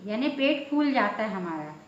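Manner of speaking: ordinary talk, nothing marked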